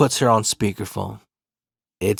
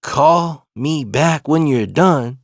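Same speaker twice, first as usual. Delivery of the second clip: slow, drawn-out repeat; incredulous echo; 2 semitones higher, much slower than usual. Retold or imitated. Cousin in back seat echoes the girlfriend, slow incredulous imitation. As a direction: stretch each word, imitating a stunned onlooker. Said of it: "my other cousin's in the back seat".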